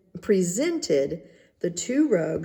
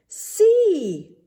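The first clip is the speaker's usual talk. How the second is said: high excited cry; cried out; 13 semitones higher, 7 dB louder than usual. A narrator's reading. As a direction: show off the invisible cloth with a cry. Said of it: "they cried at last".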